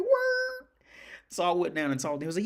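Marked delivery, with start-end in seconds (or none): 0.0-0.7 a very high, loud squeak of "word"; hammed-up "word". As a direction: squeal it as a mock reply.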